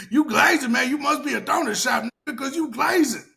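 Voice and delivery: high, loud mock taunt; mocking fan. Mocking commenters taunting him about glazing. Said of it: "They're like".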